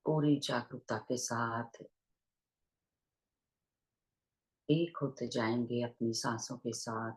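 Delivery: unhurried meditation guidance with long pauses; guided meditation. Real, not acted. Teacher leading online listeners into breath awareness, guided meditation voice. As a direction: guide listeners gently into their breath, leaving long silences.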